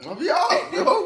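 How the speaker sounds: yelped high and loud. Real, not acted. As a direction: squeal it out in protest. Mouth burning, yelps in protest.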